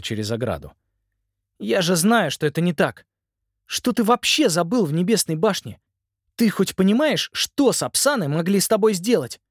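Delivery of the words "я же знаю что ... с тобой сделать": scolding. Acted. Simon scolding his brother.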